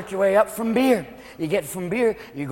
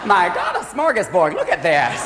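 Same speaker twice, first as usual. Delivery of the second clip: fussy choreographer calling out in drawn-out singsong, then swooning; ballet choreographer; 3 semitones higher, slower than usual. Acted. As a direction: flamboyant dance director rounding up the players. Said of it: "ballet choreographer working with a football team".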